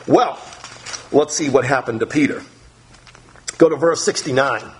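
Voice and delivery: ordinary delivery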